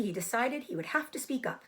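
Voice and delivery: usual speaking voice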